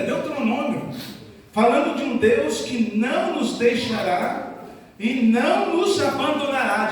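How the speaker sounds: ordinary talk, nothing marked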